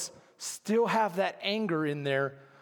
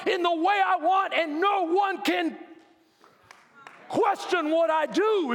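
preaching at full force; 8 semitones higher, 3 dB louder than usual. Acted. Preacher voicing God, at full force.

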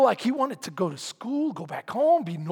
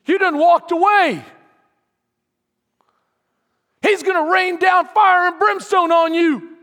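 loud, higher taunting devil voice; voice of the enemy; 6 semitones higher, 8 dB louder than usual. Retold or imitated. Preacher voicing the enemy to the congregation, loud taunting. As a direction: sneer it like the accuser mocking a runaway. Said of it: "Isn't that what the enemy says".

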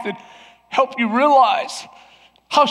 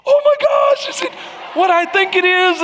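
excited squeal; 9 semitones higher than usual. Retold or imitated. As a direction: squeal it like a thrilled friend. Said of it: "Christy's getting excited".

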